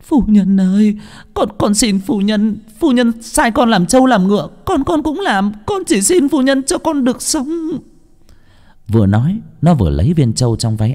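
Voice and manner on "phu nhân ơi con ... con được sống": very high wailing, stammering maid; desperate sobbing plea, acted in full; tearful begging. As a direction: wail and plead for your life, climbing higher. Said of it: "nước mắt nước mũi tèm nhem".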